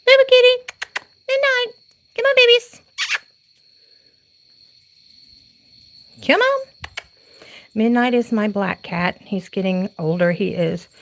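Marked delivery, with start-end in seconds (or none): 0.0-6.7 much higher sing-song pet call; calling the cats. Real, not acted. Calling her cats into the house at night, high sing-song. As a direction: coax the kitties in with a high, louder call.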